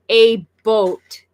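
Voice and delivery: "a boat" with a rounded o; Canadian "about". Shown as a demonstration. Showing how Canadians really say about, "boat" with a long o. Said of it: "It's closer to".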